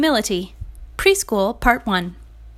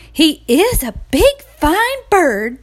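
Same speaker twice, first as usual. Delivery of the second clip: high, louder gushing admiration; excited hens; 7 semitones higher, 5 dB louder, slower than usual. A narrator's reading. Hens greeting the rooster, high and gushing. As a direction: coo over the big bird.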